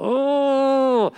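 high, loud yelp of disgust. Retold or imitated. Pastor recoiling from homeless man's runny nose, disgusted yelp. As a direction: recoil from the snot with a squeal. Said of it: "and I go".

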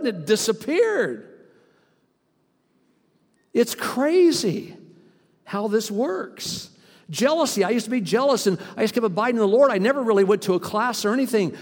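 full-force preaching. Acted. Pastor rousing the congregation, full-force preaching. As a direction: preach it to the back row.

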